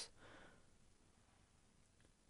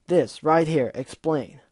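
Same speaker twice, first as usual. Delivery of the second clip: angry demand; 2 semitones higher, 7 dB louder, slower than usual. Acted. Gilda demands an explanation.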